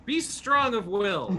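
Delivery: loud, high, slow rallying call; yelled encouragement. Acted. Hero yells encouragement to a captive in the cave, shouting. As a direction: yell it out, drawing the words long. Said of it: "And I just yell".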